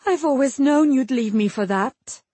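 high-pitched upset queen; distraught outburst. A narrator's reading. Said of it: "Elena burst out".

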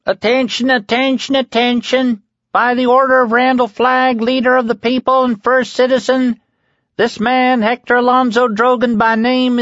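piercing high-pitched proclamation; high, slicing voice. A narrator's reading. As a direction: read out the sentence like a howling buzzsaw. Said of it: "in a high voice that sliced through Heck's screams".

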